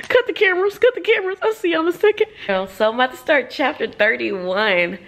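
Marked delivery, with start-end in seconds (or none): 0.1-2.3 teary cut-off. Real, not acted. Reader overcome with tears asks to stop recording, teary and high. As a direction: still crying, voice high. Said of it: "Y'all saw me crying".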